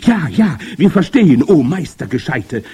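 high, loud eager acclaim; excited crowd yell; crying out. A narrator's reading. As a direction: shout adoringly as the witch audience. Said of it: "schrie das Publikum".

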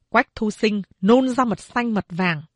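ordinary delivery, nothing marked

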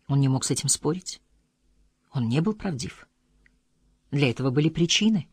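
ordinary delivery

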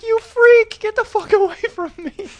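very high, loud mock screech; panicked yell. Boy fleeing his crazed piano teacher, high mock-terrified screech. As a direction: screech it like a chased kid.

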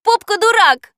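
put-on high, loud girlish taunt; shouted insult. A narrator's reading. Katya shouts at the parrot, angry high shout. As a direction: yell it sharply at the parrot. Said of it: "крикнула Катя".

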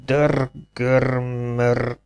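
very slow, tongue-tied attempt; stammering. A narrator's reading. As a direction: grind through each syllable on rolled r's. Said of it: "hebegte a kapitány".

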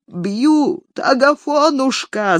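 put-on high whining old man's plea; a lightly played tearful old man; whimpering complaint to his son, mildly acted; tearful mumbling. A narrator's reading. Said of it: "зашамкал он плачущим голоском".

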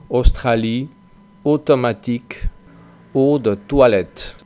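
"Australie, automatique, eau de toilette" with every "au" and "eau" as a Spanish O; French O sound. Shown as a demonstration. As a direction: read the French words with a clean O. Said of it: "vais a pronunciar como una O española". ("au" y "eau" como O española)

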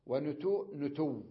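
ونتوء with the hamza, then نتو with a doubled w and no hamza; نتو softened, hamza gone; hamza then dropped. Shown as a demonstration. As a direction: show both forms slowly. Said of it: "يعني ألفظها هكذا".

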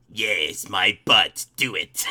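low, creepy villain impression; Emperor Palpatine. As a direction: croak it low like an evil emperor. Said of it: "sounds like Emperor Palpatine's".

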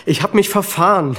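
high, pressed answer; agitated. Acted. Steve defending himself to the lieutenant, agitated and loud. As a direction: blurt it out under questioning.

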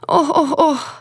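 high, pitiful put-on moans; mock plaintive groaning. A narrator's reading. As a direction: groan in imitation of the mother-in-law. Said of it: "застогнала Мотря до стоту таким жалібним голосом".

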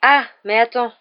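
abrupt change of mind; sudden. A narrator's reading. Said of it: "dit soudain Harry".

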